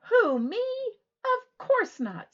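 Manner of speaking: high, loud slow mock-brave; Froggy's voice. Froggy insisting he isn't nervous, high and loud. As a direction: pretend not to be scared.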